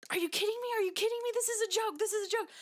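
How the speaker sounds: high-pitched, frantic repeated outburst; mock losing it. Customer pulling her hair, mock meltdown. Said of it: "she lost it again".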